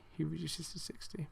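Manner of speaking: breathy sarcastic whisper; conspiratorial whisper to the viewers; whispered aside. Real, not acted. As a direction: let the punchline slip out under your breath. Sarcastic aside about a suspension.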